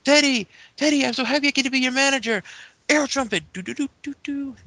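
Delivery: high, giddy put-on voice; Santino, happy. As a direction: gush happily to your new boss, hammed-up. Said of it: "Santino comes in and he's like".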